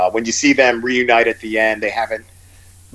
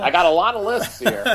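laughing; 10 semitones higher than usual. Real, not acted. Laughing at teasing about lists.

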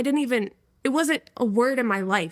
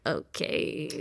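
a muted, breathy, half-swallowed okay; reluctant okay; 8 semitones lower, 8 dB quieter than usual. Retold or imitated. College girls invite her to Bible study, she gives a muffled reluctant okay. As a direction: shrug it off, barely voiced.

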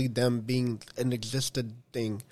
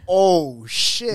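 higher, louder amazed outburst; awed exclamation; 5 semitones higher, 6 dB louder than usual. Retold or imitated. Fan retelling his reaction to the scene, awed outburst. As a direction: relive being blown away by it.

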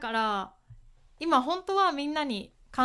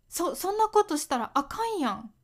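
higher-pitched Kansai-accented example; 関西弁; 6 semitones higher than usual. Shown as a demonstration. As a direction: chide casually in Kansai dialect. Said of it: "関西では使いますね。". (関西弁で高めにたしなめる感じ)

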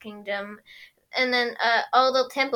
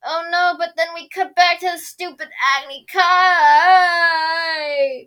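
mock dismay; 5 semitones higher, 8 dB louder than usual. Real, not acted. Mock complaint.

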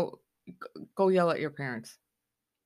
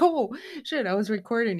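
surprised; 5 semitones higher than usual. Retold or imitated. Voiced surprise in a quote.

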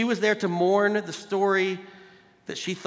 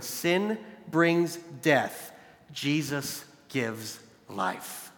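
slow emphasis; 3 semitones lower, 4 dB quieter, much slower than usual. Real, not acted. Sermon climax line.

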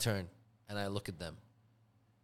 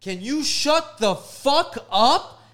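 slow, loud, higher snap; angry demand; 9 semitones higher, 7 dB louder, much slower than usual. Retold or imitated. Confronting the chatty girls behind him, slow and loud. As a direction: spit each word out slowly. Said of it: "I was like".

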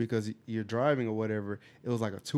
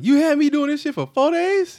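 much higher and louder, mock outrage; indignant outburst; 11 dB louder than usual. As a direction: throw it at him like you'd fight him. Imagined angry reply to prankster.

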